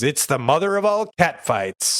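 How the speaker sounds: hammed-up episode narrator; show narrator. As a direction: announce it like a cartoon cliffhanger. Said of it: "And the narrator comes in and says".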